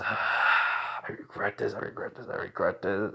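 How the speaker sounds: breathy, voiceless muttering to himself; regretful whisper. Acted. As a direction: whisper it over and over in mock regret. Embarrassed character regrets it to himself.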